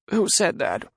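high, shaky fat man. Acted. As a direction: quaver it out nervously. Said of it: "With a shaky voice".

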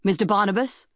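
a hint of a captain summoning someone; captain calling. A narrator's reading. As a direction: call for a crew member by name. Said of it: "called the captain".

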